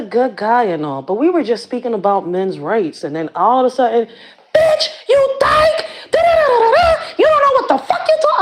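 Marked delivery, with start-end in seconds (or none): 4.6-8.4 high shrill-free yelling impression, much higher; mock screaming woman. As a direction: play the crazed lady screaming insults. Woman yelling at her, quoted.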